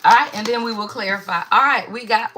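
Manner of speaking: ordinary delivery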